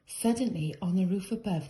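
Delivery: plain reading voice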